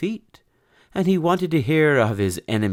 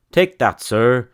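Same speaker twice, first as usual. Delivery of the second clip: loud, high strike call; triumphant cry; 4 semitones higher, 7 dB louder than usual. Acted. The knight shouting at the villain while stamping. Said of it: "he cried".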